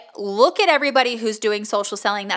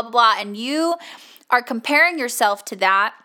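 usual speaking voice

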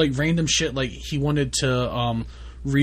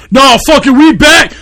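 screaming, hyped-up party shout; hype yelling; 14 semitones higher, 15 dB louder than usual. Pretend. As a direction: yell it like you're wild. Hyped yelling after mock calm.

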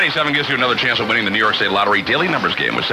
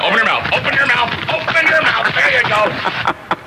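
rising higher each time, insistent; pushy flyer hander; 13 semitones higher than usual. Retold or imitated. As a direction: climb in pitch with every repeat. Pushy flyer person forcing flyer.

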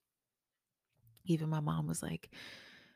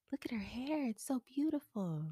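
put-on toddler voice; 9 semitones higher than usual. Toddler admiring TV hair.

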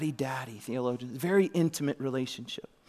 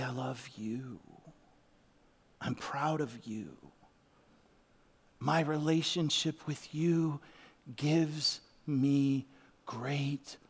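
tender voice of God; 4 dB quieter, much slower than usual. Retold or imitated. Voicing God's loving words.

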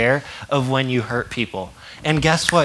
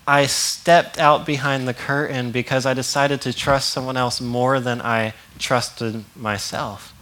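normal talking voice

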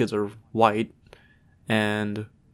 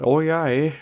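higher, louder mock Canadian accent; 5 semitones higher, 5 dB louder than usual. Showing co-host the accent, mock Newfie Canadian. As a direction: do a cartoon Canadian with a Newfie lilt. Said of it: "the stereotypical Canadian accents".

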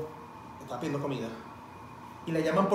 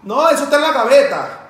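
loud, high careless chatter; mocking loud phone chat; 5 semitones higher, 14 dB louder than usual. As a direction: ham up a rude clerk on the phone.

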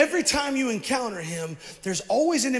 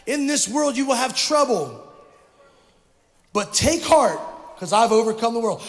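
louder for the back rows; louder repeat; 5 dB louder than usual. Real, not acted. Pastor repeating for the back rows, louder. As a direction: project it to the back of the room. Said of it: "say it again for those of you in the back".

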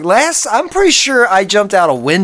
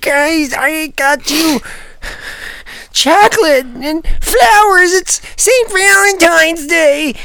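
high pleading voice; 8 semitones higher than usual. Pretend. Masked figure pleading, gifts.

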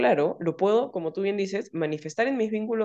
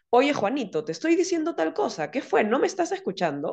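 mock anger; 7 semitones higher than usual. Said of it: "el volumen de la cólera".

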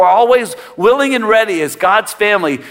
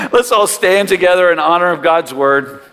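ordinary talk

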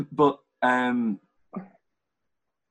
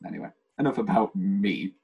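ordinary talk

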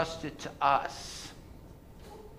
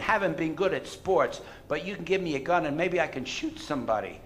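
ordinary talk, nothing marked

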